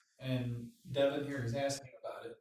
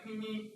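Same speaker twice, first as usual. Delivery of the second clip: a thin, soft little meep meep; small squeaky horn noise; mock weak horn beep; 6 semitones higher, 7 dB quieter than usual. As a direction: imitate a pathetic tiny car horn. Mocking a weak stock horn.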